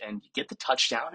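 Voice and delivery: ordinary talk, nothing marked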